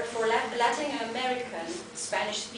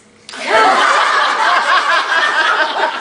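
high, loud burst of laughter; real laughter at the guide's blunder; 7 semitones higher, 17 dB louder than usual. Real, not acted. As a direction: explode into helpless laughter. Funny translation mistake.

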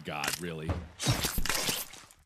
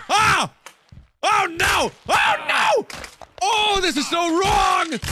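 alarmed shout; 10 semitones higher than usual. Real, not acted. Character crashing in game.

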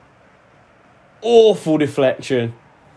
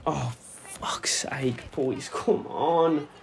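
muttered under the breath, slow; a private groan at the team; real fed-up whisper; exasperated whisper; 9 dB quieter, much slower than usual. Real, not acted. Keeper's poor ball out.

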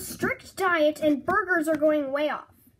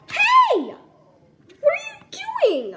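shout; 10 dB louder than usual. Acted. Protesting being sauced.

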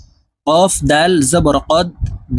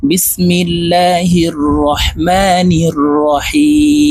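slow, measured Arabic recitation with elongated vowels; Quranic recitation; much slower than usual. Acted. Teacher opening the class with slow measured recitation. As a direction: intone the words as if opening Quran reading.

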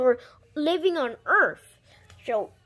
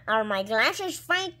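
ordinary delivery

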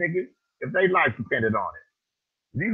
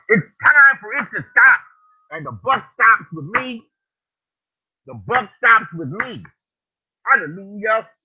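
loud, high preacher's cry; preaching shout; 5 semitones higher, 5 dB louder than usual. Acted. Preacher shouting to his viewers, loud and high.

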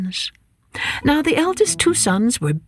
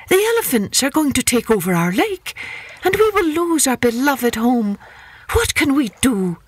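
pleading hares; 6 semitones higher than usual. Acted. Hares beg king for help.

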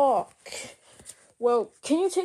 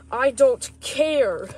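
slow loud I don't care; slow loud cross refusal; angry refusal; 6 dB louder, much slower than usual. Acted. Chef Fox angry retake.

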